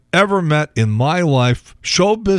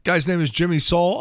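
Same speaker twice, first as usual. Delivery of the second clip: ordinary talk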